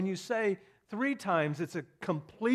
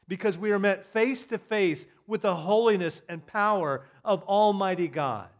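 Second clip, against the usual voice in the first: preaching swell; 4 semitones higher, 9 dB louder than usual. Real, not acted.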